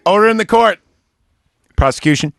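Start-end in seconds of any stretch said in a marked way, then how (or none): 0.0-0.8 shouting. Acted. Judge silences the court.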